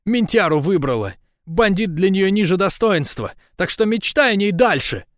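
high, loud woman's jeer; gloating high-pitched tirade; spiteful taunting. A narrator's reading. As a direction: needle him in a high, mocking voice. Lika taunts him about a rival.

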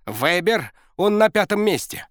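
barking coach. A narrator's reading. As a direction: call it out eagerly. Coach shouting standings.

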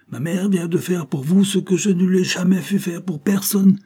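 high, eager exclamation; bursting with pride at the news; excited cry. Acted. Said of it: "s'écria le père".

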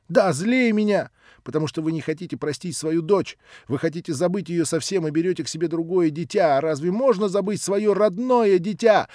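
put-on high, loud child's tirade building to a shriek; rising fury ending in a scream of не хочу; furious accusation. Nelly accuses the old man of cruelty, mounting shout.

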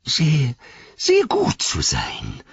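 slow, drawn-out creature voice; cringing Gollum. A narrator's reading. Gollum promising Frodo to be good, cringing. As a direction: stretch out the submissive promise.

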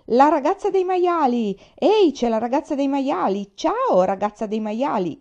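shouted high and loud, a mocking insult; boy jeering at a girl walking past, shouting; shouted taunt. A narrator's reading. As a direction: yell it after her, jeering. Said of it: "le gridò dietro".

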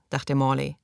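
ordinary delivery

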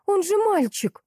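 very high, astonished exclamation; excited yell. A narrator's reading. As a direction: squeal the discovery, without real volume. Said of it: "заорала я". (высокий изумлённый возглас)

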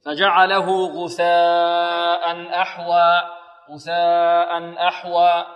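loud, very slow chant on a steady note; Quran recitation. Shown as a demonstration. Teacher recites verse for pupils, loud sustained tajweed chant. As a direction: hold the long vowels evenly and project.

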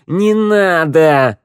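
high, slow desperate plea; pleading cry. A narrator's reading. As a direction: beg him to stop. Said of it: "взмолилась Шныряла". (мольба)

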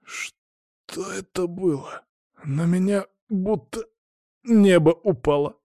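in pain, short of breath. A narrator's reading. Injured boy asks what hit him, pained and breathless. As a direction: labour through the words as if winded. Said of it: "кривился от боли и пытался выровнять дыхание".

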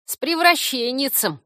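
high, loud, gleeful creature voice; joyful congratulation. A narrator's reading. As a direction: blurt out happy congratulations. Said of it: "радостно приветствовал меня Корняга".

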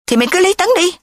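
sharp high-pitched interruption; cutting in. A narrator's reading. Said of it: "Nga ngắt".

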